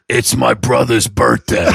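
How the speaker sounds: put-on strained friend voice; Brian. Friend explains the cars, strained imitation. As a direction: play the buddy, voice strained. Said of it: "He goes".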